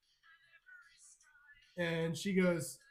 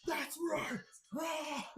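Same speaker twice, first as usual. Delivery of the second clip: high, strained victory cry; mock triumphant cry, high but held back in volume; triumphant roar; 9 semitones higher, 5 dB quieter, much slower than usual. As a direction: crow like a champion lifter, pitched high. Acts lifter gloating after the throw.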